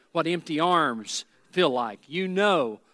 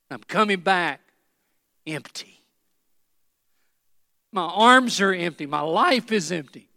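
choked up lament; 3 semitones higher, 6 dB louder than usual. Acted. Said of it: "choked up in her voice".

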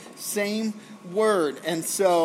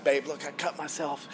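softer, a touch of a whimper; mock whiny complaint; 5 semitones lower, 6 dB quieter than usual. A husband showing his wife a scratch, softly whiny. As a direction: play up a tiny scratch to his wife, quietly. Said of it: "I'd be like".

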